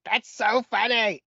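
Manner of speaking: high, drawn-out mock Canadian; exaggerated Canadian girl impression; Canadian accent. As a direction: imitate an amused Canadian, stretching words. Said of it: "playing with this Canadian chick".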